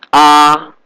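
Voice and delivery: A held high and flat; first tone. Shown as a demonstration. Teacher reading the tone table, level A. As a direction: sustain A on one high pitch. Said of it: "The first tone is flat".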